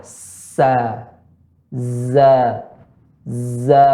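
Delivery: SA and ZA with back of tongue kept down; hissed S, buzzed Z in "Sa, za"; light sa, za. Shown as a demonstration. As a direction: stretch "Sa, za", thin and open. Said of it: "Punggung lidah tidak naik ke atas".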